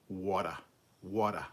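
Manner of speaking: "water" with no R, ending in "ah"; Australian accent. Shown as a demonstration. Streamer showing viewers how Australians say water, Australian accent. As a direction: say water the Aussie way, "wat-ah". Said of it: "word that Australians say that I really like the sound of".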